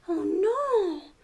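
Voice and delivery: usual speaking voice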